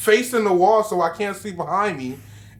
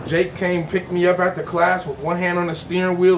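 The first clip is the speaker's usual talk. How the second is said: ordinary delivery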